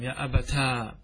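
long open aa closing أبتا; retained alif. Shown as a demonstration. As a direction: hold the final aa of أبتا. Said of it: "أثبت الألف".

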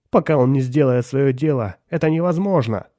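loud, high, desperate protest; breaking voice. A narrator's reading. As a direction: protest with a voice about to crack. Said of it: "срывающимся голосом произнёс её муж". (срывающимся голосом, громко)

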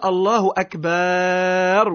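"أكبر" with a long drawn-out a between ب and ر, very slow; wrongly stretched akbar. Shown as a demonstration. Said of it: "ويمد فيها".

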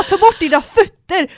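loud, high, drawn-out angry order; hitting the roof. Retold or imitated. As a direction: re-enact blowing your top, slowly and loudly. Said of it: "alltså jag gick i taket". (rasande, högt återgiven tillsägelse)

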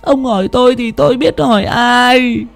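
put-on old wife sniffling with dread; tearful whimper. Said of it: "nước mắt lã chã, nhìn ông Long mà thút thít".

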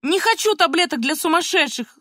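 high, strained attempt to shout; acted cracked cry of protest; voice breaking in a cry. A narrator's reading. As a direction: try to scream the refusal. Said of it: "сорвавшимся голосом попыталась крикнуть Полина".

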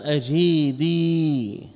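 أجيدي stretched very slowly, keeping the ya; slow down on أجيدي to show the ya stays; drawn-out word. Shown as a demonstration. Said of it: "تبقى الياء ولا تحذف". (أجيدي ممدودة ببطء مع إبقاء الياء)

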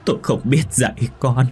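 high, loud wailing lament; sobbing wail. A narrator's reading. Widow begging her dead husband's forgiveness, sobbing wail. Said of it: "nức nở gào lên".

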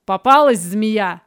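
shouted, pitch jumping up at the start; triumphant shout. A narrator's reading. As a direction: call it out like catching prey. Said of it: "крикнул он".

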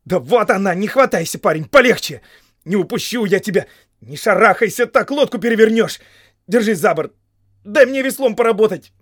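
loud, high rescue orders; exasperated, pressing calls; urgent shouting. A narrator's reading. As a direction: shout instructions to a panicking swimmer.

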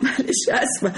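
sorry, said through a laugh; laughing apology. Acted. Maid laughing it off after calling her mistress mother. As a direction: laugh off the blunder while apologizing.

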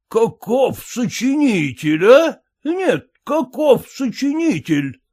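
high, slow indignant exclaim; indignant amazement. A narrator's reading. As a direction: marvel at the boy's cheek, outraged. Outraged father. (отец возмущённо изумляется выдумке сына)

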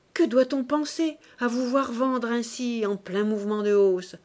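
reproche violent, plus fort; assène l'accusation avec force; violemment. A narrator's reading. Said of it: "continuait Saccard violemment". (reproche violent et appuyé)

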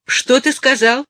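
high, loud displeased question; testy mother on the phone. A narrator's reading. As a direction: demand a repeat, annoyed. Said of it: "недовольно спросила мать".

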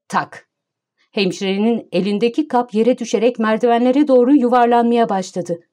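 usual speaking voice

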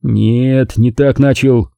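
plain reading voice

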